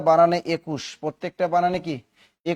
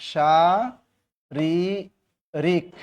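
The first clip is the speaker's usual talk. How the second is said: very slow, each syllable apart; much slower than usual. Shown as a demonstration. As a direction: spell it out slowly for the class. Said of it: "এবার দেখো শারীরিক".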